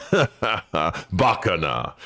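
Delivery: slow scornful Japanese aside with a laugh; a lightly voiced, slow dismissive Japanese scoff; Heihachi in Japanese. Heihachi looking down at his son, slow scornful Japanese. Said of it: "he's speaking in Japanese".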